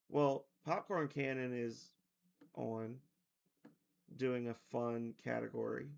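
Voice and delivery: ordinary talk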